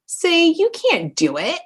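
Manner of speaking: high, slow taunt of the doubting brain; mocking inner critic. The brain's inner critic taunting, high and slow. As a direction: sneer it like a taunting voice. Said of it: "brain's old way... and saying".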